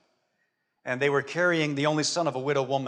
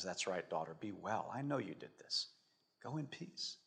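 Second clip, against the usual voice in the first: very soft, near-hushed blessing; tender reassurance; 6 semitones lower, 18 dB quieter than usual. Acted. Jesus blessing the woman he healed, tender and hushed. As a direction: comfort her gently, barely above a murmur.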